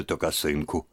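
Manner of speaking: ordinary delivery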